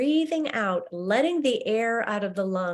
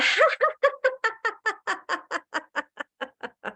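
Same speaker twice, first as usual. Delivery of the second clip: high, long laugh that falls away; big released laugh; 14 semitones higher than usual. Shown as a demonstration. Teacher demonstrating the release laugh for the class, open. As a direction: let a whole belly laugh burst out. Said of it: "release with a".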